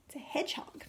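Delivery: breathy hushed reveal, no voice; whisper. Real, not acted. Teacher whispering the animal's name to the children. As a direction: whisper the answer like a secret.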